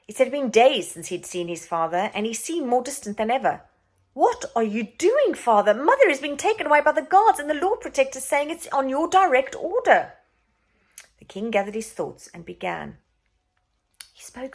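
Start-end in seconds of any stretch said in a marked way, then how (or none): 4.1-10.2 urgent plea. Acted. Boy pleads with father.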